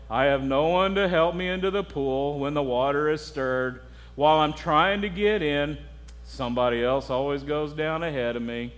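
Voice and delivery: low, glum, droning donkey impression; Eeyore. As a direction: imitate a hopeless, self-pitying donkey. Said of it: "in Eeyore's voice".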